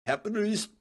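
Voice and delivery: put-on capitalist in a high, jumped-up Russian accent. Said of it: "I tried to put on my best Russian accent there".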